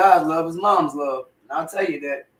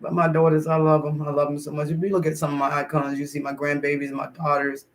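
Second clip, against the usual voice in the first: ordinary talk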